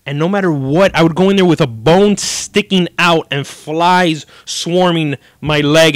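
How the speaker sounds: ordinary talk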